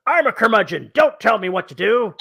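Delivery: mock grumpy old grouch, laughing; lightly voiced curmudgeon. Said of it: "And he goes".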